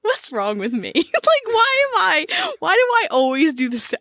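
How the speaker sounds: high, giggly disbelief; real cracking-up; laughing self-mockery. Real, not acted. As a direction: wail it through laughter. Laughing at own sound effect.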